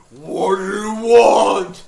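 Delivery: slow, loud, roaring cave voice; booming tiger head. Retold or imitated. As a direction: bellow it like a giant stone beast. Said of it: "the tiger's head just".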